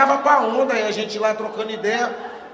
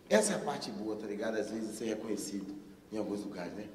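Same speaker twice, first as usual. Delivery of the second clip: confiding murmur; 16 dB quieter than usual. Real, not acted. Soft intimate aside.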